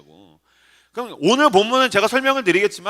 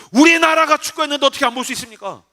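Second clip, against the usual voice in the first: mock outrage, pitched high; indignant fan; 7 semitones higher, 4 dB louder than usual. Young soccer fan protesting to his pastor, mock indignation. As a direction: imitate a fired-up young man protesting, high-voiced.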